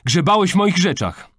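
high, loud outraged accusation; outraged teenage outburst; indignant. A narrator's reading. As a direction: accuse him, scandalised. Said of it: "oburzyła się Kamil".

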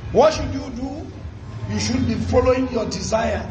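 usual speaking voice

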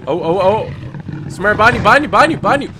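shouting in alarm; urgent warning shout. Real, not acted. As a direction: yell the warning high and fast. Warning partner of monster.

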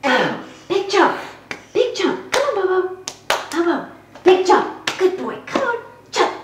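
very high, coaxing pet talk; high voice to a dog. Acted. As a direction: cheer the dog on, sky high.

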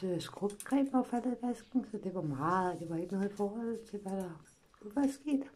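ordinary talk

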